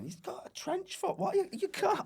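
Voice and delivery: high, squeaky mock protest; upset sub. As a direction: squeal it out in comic outrage. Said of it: "I'm, like, going".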